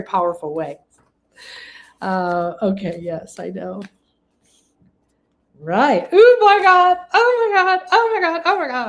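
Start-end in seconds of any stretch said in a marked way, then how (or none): 6.1-8.9 high, loud shrieks of joy easing down; real excited squeals fading off; overjoyed exclamations. Real, not acted. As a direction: burst out in delight, then let it settle. Overwhelmed by the reading.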